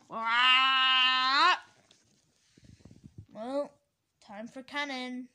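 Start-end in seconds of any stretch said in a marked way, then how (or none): 0.1-1.6 loud, drawn-out pretend scream; long scream. Stuck customer crying out, long loud scream. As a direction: hold a loud "aah".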